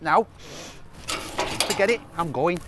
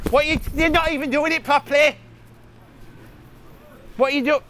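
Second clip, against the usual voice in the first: higher, sharp telling-off of his little sister; nasty scolding; 4 semitones higher than usual. Real, not acted. Big brother angrily scolding his crying sister over the kite. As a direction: scold her hard for getting it wrong. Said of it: "that need to be nasty".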